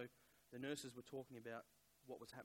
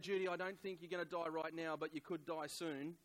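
higher, louder blunt warning; stressed, tactless urging; 4 semitones higher, 6 dB louder than usual. Retold or imitated. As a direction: retell a stressed, tactless blurt. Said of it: "I was stressed".

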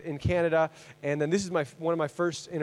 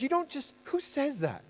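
shocked; 9 semitones higher, 7 dB quieter than usual. Retold or imitated. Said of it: "And I'm like".

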